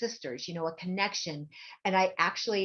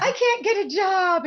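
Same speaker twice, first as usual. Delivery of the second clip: high, loud moaning complaint; high whiny plea; 12 semitones higher, 9 dB louder than usual. Retold or imitated. Complaining to the psychic, high and whining. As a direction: whine it up in a high pitch. Retelling her whiny old questions.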